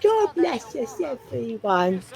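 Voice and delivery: put-on high, slow, sugary little-boy voice; Tiny Tim impression. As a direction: squeak it out like a frail Victorian child. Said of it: "probably because of Tiny Tim".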